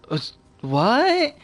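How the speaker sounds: high, slow, baffled retold "what? huh?"; caught off guard. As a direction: play it as a stunned, confused double take. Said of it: "And I'm just kinda, like, caught off guard".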